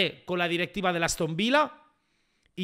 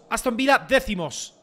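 usual speaking voice

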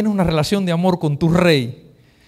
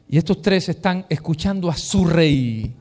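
ordinary talk, nothing marked

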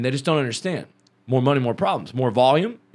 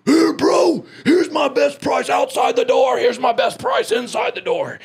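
dad's furious yell, much higher and louder; angry shout; 12 semitones higher, 4 dB louder, slower than usual. Retold or imitated. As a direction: roar it like an enraged dad grabbing a man. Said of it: "My dad said".

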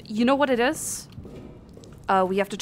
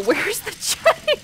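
high, drawn-out question breaking into laughter; giggly mock desperation; 10 semitones higher, slower than usual. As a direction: stretch it high and let it collapse into laughing. Comic repeated search for generator.

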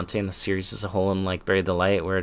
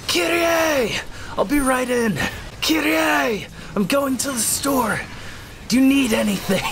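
high, hammed-up yelling over trivia; mock anguished scream, pitched way up; Nero, intensely dramatic. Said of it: "He's just always dramatic".

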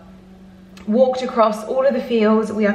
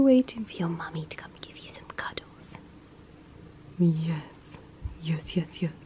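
soft whisper to a pet; 5 semitones lower, 11 dB quieter than usual. Real, not acted. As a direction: whisper lovingly to the dog. Whispering to the dog.

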